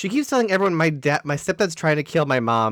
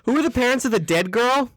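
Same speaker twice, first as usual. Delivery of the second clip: mock doctor calling out, pitched up; indiscreet doctor; 7 semitones higher than usual. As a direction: play the tactless doctor, higher voice. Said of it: "the doctor wasn't quiet".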